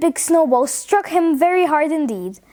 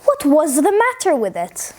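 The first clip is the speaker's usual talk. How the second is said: plain reading voice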